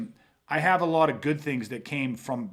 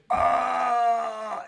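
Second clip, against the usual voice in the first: drawn-out high "ah"; retold cry, held long and high; 8 semitones higher than usual. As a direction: hold one high, steady "aah". Re-enacts sound in the remembered moment.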